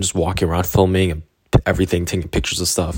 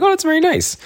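mock-polite high voice. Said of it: "I'm like".

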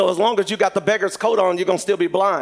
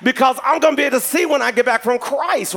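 loud, raised acted declaration; exclaimed confidence; 7 semitones higher, 4 dB louder than usual. Healed blind man throwing off his coat, loudly confident.

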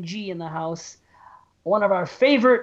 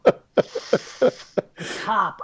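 ordinary talk

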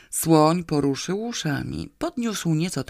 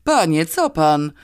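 louder, taken-aback challenge; astonished; 6 dB louder than usual. A narrator's reading. Policeman to a screaming drunk, astonished. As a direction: question him in amazement. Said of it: "powiedział zdumiony milicjant".

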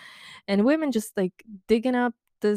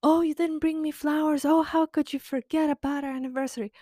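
put-on high-pitched whiny nagging girlfriend; mock girlfriend frenzy, pitched way up; mock frenzied girlfriend; 8 semitones higher than usual. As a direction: whine it up high like a wronged girlfriend. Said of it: "make you go into a frenzy of".

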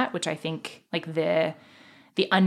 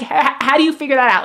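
amazed disbelief; 7 semitones higher, 8 dB louder, much faster than usual. Retold or imitated. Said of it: "I'm like".

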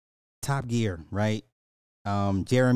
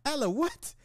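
high, softer puzzled voice; mock a confused wife, pitched way up; imagined baffled wife; 5 dB quieter than usual. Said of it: "imagine me telling the wife".

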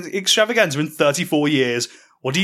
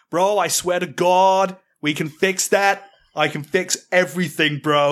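put-on New York accent; 3 semitones higher, slower than usual. New York bro voice again.

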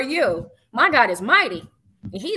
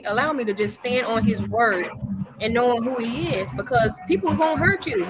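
ordinary talk, nothing marked

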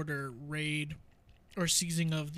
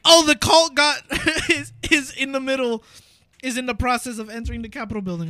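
shocked news retelling; 7 semitones higher, 12 dB louder than usual. Retold or imitated. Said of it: "It's like".

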